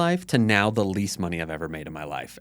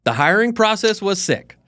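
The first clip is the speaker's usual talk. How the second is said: much higher and louder, hyped; excited; 11 semitones higher, 6 dB louder than usual. Real, not acted. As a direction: shout the praise with real enthusiasm. Hyped agreeing with host.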